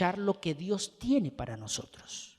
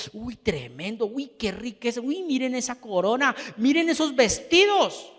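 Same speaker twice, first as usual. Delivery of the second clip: builds from a murmur to a louder, higher gasp of wonder; oohing at the crown, getting more thrilled; dazzled, rising awe; 5 semitones higher, 4 dB louder than usual. Retold or imitated. Preacher playing a dazzled onlooker at the royal court, rising excitement.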